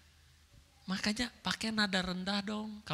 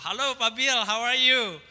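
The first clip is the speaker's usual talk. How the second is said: bright-spirited hello, higher and louder; happy greeting; 4 semitones higher, 5 dB louder than usual. Acted. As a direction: greet him happily, pitch lifted. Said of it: "When you happy". (sapaan ceria dan bersemangat)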